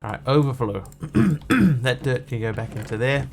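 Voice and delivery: throaty voice, clearing throat; sore throat. Real, not acted. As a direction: talk through a sore throat. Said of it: "Sorry about my, um, throat today".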